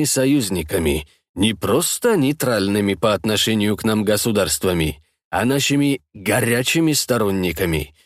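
measured authoritative statesman. Acted. Leader lays out plan.

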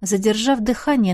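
ordinary delivery

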